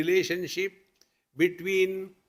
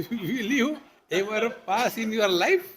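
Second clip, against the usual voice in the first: higher, laughter breaking into the words; laughing mock scolding; 5 semitones higher than usual. Father scolding his child for failing, played with laughter. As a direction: scold through your own laughter.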